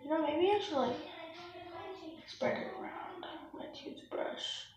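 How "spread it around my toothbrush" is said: soft unvoiced whisper. Real, not acted. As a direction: whisper it to yourself. Whispered aside.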